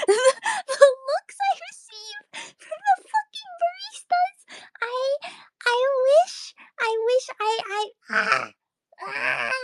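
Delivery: high wheezing laugh-cry. Real, not acted. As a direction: squeak through tears of laughter. Laugh-crying over embarrassment.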